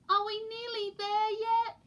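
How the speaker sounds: high, whining; whiny penguin chicks. A narrator's reading. Chicks nag the polar bear on trip, high. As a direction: nag like bored kids. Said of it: "said Peaky, Poots and Pog".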